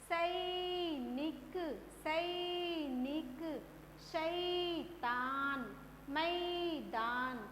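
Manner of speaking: सैनिक very slow, first syllable stretched; word-by-word सैनिक, शैतान, मैदान with long-held first syllables; stressed long ai. Shown as a demonstration. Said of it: "बा को हमने जोर देकर बोला".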